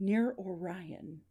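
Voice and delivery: slow, soft mysterious caller; faint, measured phone voice; weak, unclear voice. Acted. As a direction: let each word out slowly and faintly. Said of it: "This part is weak and unclear".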